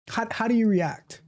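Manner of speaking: ordinary talk, nothing marked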